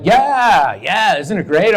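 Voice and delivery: enthusiastic. Retold or imitated. Stoned roommate gushing about the Renaissance fair. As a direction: sell how great it is.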